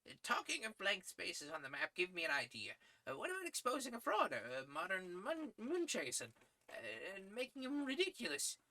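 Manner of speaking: put-on high, thin voice of a kindly old editor. Editor praising young Malone's articles, high old-man voice. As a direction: pitch him up as a fussy old newsman. Editor proposes a fraud story.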